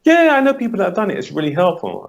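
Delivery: mock client gushing about ultrasound; imitating a client. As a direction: pitch way up and rush it like a keen client. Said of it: "client answers about ultrasound".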